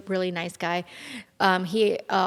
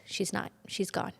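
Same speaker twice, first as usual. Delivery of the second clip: very soft, heavy news; hushed grieving words; sad; 10 dB quieter than usual. Retold or imitated. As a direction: break it to her quietly. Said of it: "And he goes".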